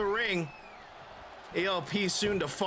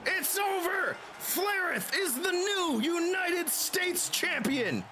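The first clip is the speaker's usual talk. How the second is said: screaming finish call, much higher; wrestling commentator; 13 semitones higher than usual. Acted. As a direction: shout the big title-win call. Match finish in game commentary.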